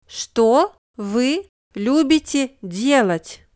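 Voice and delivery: ordinary talk, nothing marked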